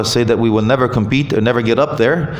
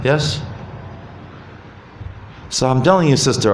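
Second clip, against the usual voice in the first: ordinary talk, nothing marked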